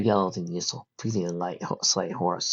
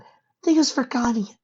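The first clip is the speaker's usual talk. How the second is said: put-on young filly voice. Fireside's voice.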